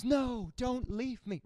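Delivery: hammed-up telenovela despair; melodramatic soap plea. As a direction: beg like a soap opera lover. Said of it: "so melodramatic".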